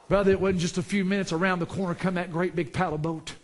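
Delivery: ordinary talk, nothing marked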